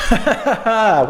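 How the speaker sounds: breathless laugh after playing; chuckling while catching breath. Real, not acted. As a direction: laugh it off, winded. Laughing after a tiring passage.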